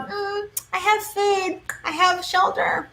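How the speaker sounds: high, soft, slow contented hen voice; lazy hen. Retold or imitated. Reader mocking Leos stuck in the coop, smug hen voice. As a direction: play a smug chicken sitting in the coop. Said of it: "waiting for food all day going".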